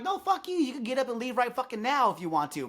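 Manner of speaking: high-pitched argumentative comeback; retold heated comeback. Server going at it with a rude guest, heated retort. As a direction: replay firing right back in an argument. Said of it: "We're going at it".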